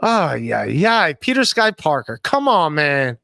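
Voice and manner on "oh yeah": ordinary delivery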